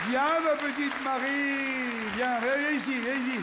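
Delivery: tender coaxing of a shy volunteer. Real, not acted. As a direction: lure her gently to the stage.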